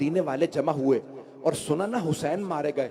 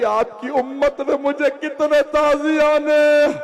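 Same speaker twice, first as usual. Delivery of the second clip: high, loud keening; wailing cry; 10 semitones higher, 6 dB louder than usual. Acted. Zainab crying to her grandfather about the lashes. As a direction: break into a lamenting wail. Lament peak, Zainab's complaint.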